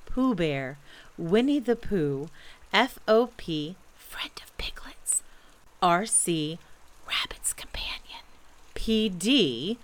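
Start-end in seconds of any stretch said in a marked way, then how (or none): 4.0-5.2 slow, confiding whisper; hushed, soft gloss; whispered aside. A narrator's reading. Aside spelling out Pooh's titles. 7.1-8.2 soft confiding whisper; hushed gloss on the initials; whispered aside. A narrator's reading. Aside spelling out Pooh's titles.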